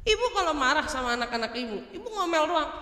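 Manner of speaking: ordinary talk, nothing marked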